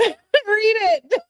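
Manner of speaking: very high squeal of laughter; high-pitched, breaking into laughs; laughing. Real, not acted. Laughs at chat urging her.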